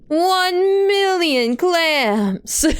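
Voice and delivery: high, very slow gloating drawl; slow villain gloat. Acted. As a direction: savor every word like a villain. Villain gloats over price.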